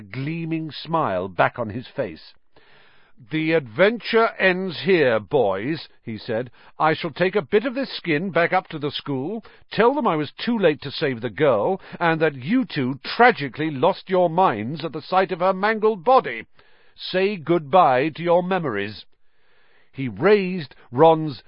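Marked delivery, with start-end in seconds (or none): 3.3-5.9 higher, louder gloating villain; smug villain Lockhart. Acted. Lockhart gloating at the trapped boys, higher villain. 6.8-19.1 higher, louder gloating villain; smug villain Lockhart. Acted. Lockhart gloating at the trapped boys, higher villain.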